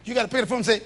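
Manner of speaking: normal talking voice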